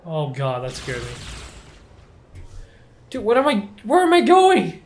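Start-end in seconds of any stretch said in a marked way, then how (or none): 3.1-4.8 high, loud confused yelp; panicked where am I going. Real, not acted. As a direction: cry out lost and flustered. Lost and panicking in game.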